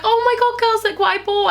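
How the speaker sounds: high excited squeal over new clothes; excited showing off. Retold or imitated. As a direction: gush to the girls about shopping. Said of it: "I'd be like".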